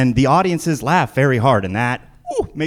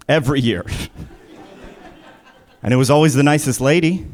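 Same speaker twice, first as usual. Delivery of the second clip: ordinary delivery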